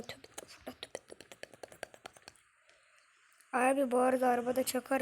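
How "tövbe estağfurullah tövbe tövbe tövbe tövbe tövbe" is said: breathless whispered "tövbe" under the breath; real exasperated whisper, very soft; whispered repentance. Real, not acted. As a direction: mutter the prayer to calm down. Exasperated muttered prayer after outburst.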